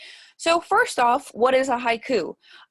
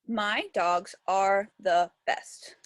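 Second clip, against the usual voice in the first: very slow, low, word by word; composing aloud; 4 semitones lower, much slower than usual. Shown as a demonstration. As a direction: dictate the line slowly to yourself. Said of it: "So I think I'm going to put".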